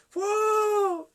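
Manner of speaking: high, loud sustained "fu"; imitated whoosh. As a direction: mimic a crowd pouring out. Imita salida de muchos hermanos. ("fu" agudo, fuerte y sostenido)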